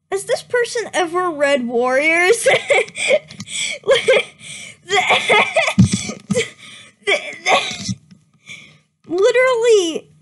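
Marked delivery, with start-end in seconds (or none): 2.5-4.8 shrieking laugh. Real, not acted. Reader loses it at the fic, shrieking laughter. 4.9-10.1 high, loud sputter of disbelief; incredulous outburst. Real, not acted. Reader appalled by the fic, sputtering louder.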